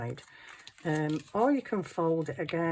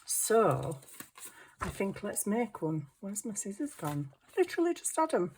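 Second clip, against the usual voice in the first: normal talking voice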